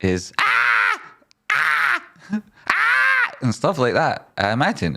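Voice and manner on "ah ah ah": shrill pretend screams climbing higher; mock screams. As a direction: scream like you're on fire, hammed-up. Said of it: "mostly what you'll be thinking, is".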